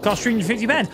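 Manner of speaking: normal talking voice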